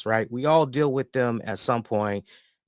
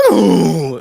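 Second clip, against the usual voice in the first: loud, drawn-out pleading dog howl; imitated dog begging; 5 semitones higher, 11 dB louder than usual. Owner mimics his dog begging at mealtime, pained pleading howl. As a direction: wail like a dog begging for food. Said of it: "she begs like somebody shot her".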